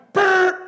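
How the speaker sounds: loud, high mock alarm buzz; scanner buzz. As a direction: buzz it out like the machine going off. Mimics the airport scanner alarm.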